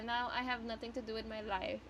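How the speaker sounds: ordinary delivery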